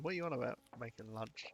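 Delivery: usual speaking voice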